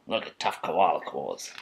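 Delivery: ordinary delivery, nothing marked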